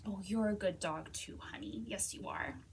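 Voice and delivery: quiet tender praise; cooing to the dog. Real, not acted.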